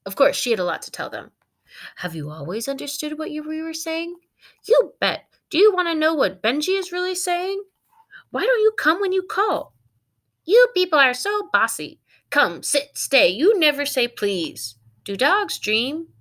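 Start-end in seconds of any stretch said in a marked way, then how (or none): 2.0-4.2 amazed family member. Acted. 4.7-16.1 lightly voiced dog. Martha the dog talking.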